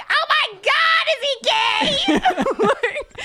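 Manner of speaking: hammed-up retold laugh-shout; shrieking with laughter. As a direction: scream it through laughter.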